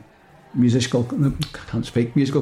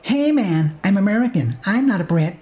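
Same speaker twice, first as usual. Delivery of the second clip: put-on American accent; 4 semitones higher than usual. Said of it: "says Moonbeam".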